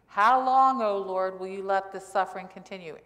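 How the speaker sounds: loud pleading wail on How long; whining to God. Retold or imitated. Prophet Habakkuk complaining to God about suffering, loud whining plea. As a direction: moan it up at God like a worn-out complainer. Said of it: "he is whining to God".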